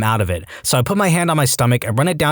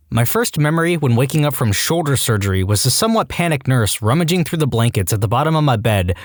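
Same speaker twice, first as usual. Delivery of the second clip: plain reading voice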